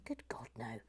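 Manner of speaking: low, soft mock-solemn aside; muttered denial. Host promising to keep the theme, muttered denial. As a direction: say it under the breath. First of three escalating denials.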